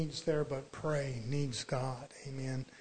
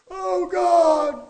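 very high, loud mock wail to God; long imitation wail of a desperate believer; mock crying out; 13 semitones higher, 16 dB louder than usual. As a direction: cry out in hammed-up desperation. Mimics desperate cry to God.

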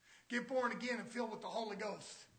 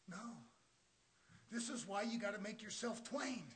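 ordinary talk, nothing marked

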